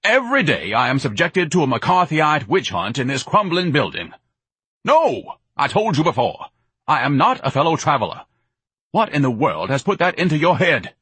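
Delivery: high-pitched, pompous outrage; indignant bellowing. Acted. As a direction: rant grandly at your mother. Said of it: "Ignatius bellowed".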